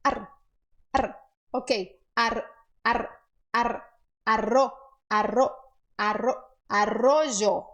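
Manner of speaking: Ar, ar, arro built slowly into arrojo; slow drill from ar to arrojo; building arrojo. Shown as a demonstration. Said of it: "Isso, faz".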